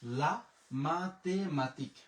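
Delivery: লা মাতেমাতিক drawn out syllable by syllable; slow careful demo. Shown as a demonstration. Teacher modelling the French word for students, very slow. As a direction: spell it out in sound very slowly, like a model for learners. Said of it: "এখানে পাইলাম হচ্ছে ক".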